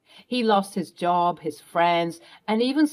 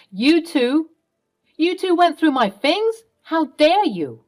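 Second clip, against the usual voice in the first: outraged shout; 8 semitones higher, 5 dB louder, slower than usual. Acted. Tom caught, outraged.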